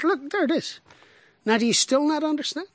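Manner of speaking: with rising pitch, "still" leaning in; Jesus exasperated. Shown as a demonstration. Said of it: "we don't get tone".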